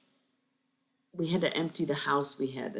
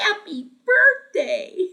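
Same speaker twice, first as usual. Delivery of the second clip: very high, slow squeaky toy voice, laughing; talking toy voice; 16 dB louder, much slower than usual. Retold or imitated. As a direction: chirp it like a toy saying happy birthday. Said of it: "There was one of the sounds".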